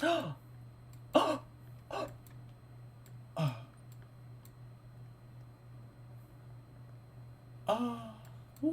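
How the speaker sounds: high repeated shocked oh. Real, not acted. Gamer reacts to a scandal on screen, high repeated oh. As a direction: keep gasping out oh.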